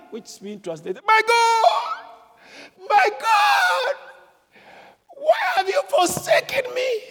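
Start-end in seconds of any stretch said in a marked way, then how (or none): 1.1-7.0 very high, loud, drawn-out cry; anguished shout. Retold or imitated. Preacher voicing Jesus' last cry to God, full loud shout. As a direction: wail it out as a dying man calling to God. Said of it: "the way Jesus shouted".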